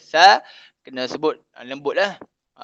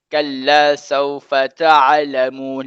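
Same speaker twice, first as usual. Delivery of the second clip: slow, drawn-out recitation; teacher modelling the verse for students, slow recitation; Quran recitation; 4 dB louder, much slower than usual. Shown as a demonstration. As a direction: chant the verse slowly and evenly.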